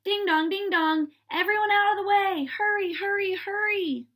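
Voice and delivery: high, loud and fast siren call; fire bell call. A narrator's reading. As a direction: clear the street, ringing and urgent. Fire engines racing through streets.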